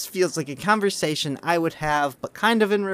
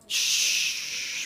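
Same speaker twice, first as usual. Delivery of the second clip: drawn-out hissed shush; playful hush to stop a spoiler; long shush; 3 dB quieter than usual. Pretend. Shushing co-host over spoilers.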